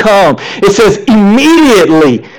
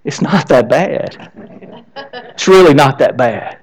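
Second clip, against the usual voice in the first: low, soft and unhurried comfort; calm someone down, lightly acting the rescuer; reassuring; 5 semitones lower, 4 dB quieter than usual. Jesus comes to someone who thinks they are drowning, gently reassuring.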